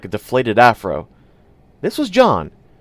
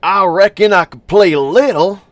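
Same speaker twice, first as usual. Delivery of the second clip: high, loud put-on Southern accent; fake Southern accent; 8 semitones higher, 6 dB louder than usual. A narrator's reading. John pretending to be a stranger, in a fake Southern accent. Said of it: "John said in a fake Southern accent".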